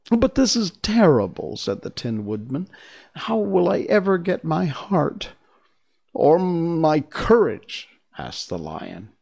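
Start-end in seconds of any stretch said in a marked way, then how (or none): 0.1-5.3 despairing. Acted. Tin Woodman in despair. 6.1-7.9 despairing. Acted. Lion despairs.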